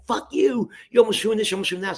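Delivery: high-pitched, heated tirade; imitated angry cussing. Caller blaming the cameraman over the phone, imitated angry cussing. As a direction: mimic an irate caller laying into you. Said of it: "he's cussing so hard".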